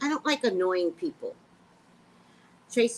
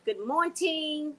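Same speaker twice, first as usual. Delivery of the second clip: slow host greeting; 6 semitones higher, slower than usual. Shown as a demonstration. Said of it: "just say good morning".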